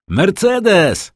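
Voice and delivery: high, loud happy shout of her name; a lover returning calls out to his girl with joy; joyful call. A narrator's reading. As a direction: call her from outside the hut, overjoyed. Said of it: "radosne wołanie".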